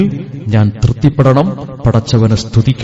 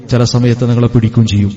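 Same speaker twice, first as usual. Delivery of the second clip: usual speaking voice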